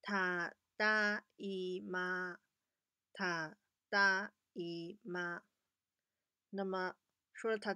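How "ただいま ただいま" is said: very slow, careful ただいま; teacher modelling the greeting; slow model tadaima. Shown as a demonstration. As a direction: sound out ただいま for children. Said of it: "首先第一句话是".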